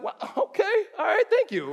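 high-pitched, oblivious thanks; naively grateful. Acted. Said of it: "if you don't get that language, you're like".

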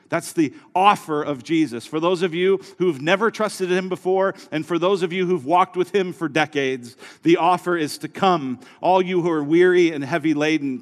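fervent sermon delivery; preaching. Acted. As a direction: drive it home from the pulpit. Altar call preaching.

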